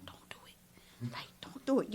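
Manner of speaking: hushed whisper across the table; urgent whisper so he can't hear; whispered warning. Retold or imitated. Whispering to goddaughter not to eat it.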